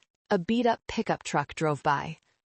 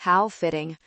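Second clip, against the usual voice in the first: usual speaking voice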